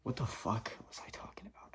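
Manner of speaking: whispered aside to himself; lost the thread, hushed to yourself; real whisper. Real, not acted. Loses his train of thought.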